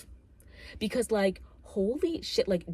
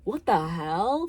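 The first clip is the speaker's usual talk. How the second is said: mock outrage; 3 semitones higher, 6 dB louder, much slower than usual. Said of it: "I'd be like".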